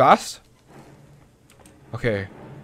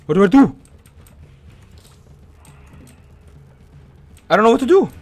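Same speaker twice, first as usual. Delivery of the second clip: panicked; 7 semitones higher, 5 dB louder, much faster than usual. Real, not acted. Trapped by monster.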